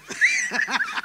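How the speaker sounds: high burst of laughter; amused laugh. Real, not acted. Preacher amused by woman calling herself panicky, laughing. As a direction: laugh out at the funny word.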